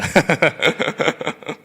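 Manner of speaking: slow, laughing through the words; can't stop chuckling while saying it. Real, not acted. Laughing at the child story.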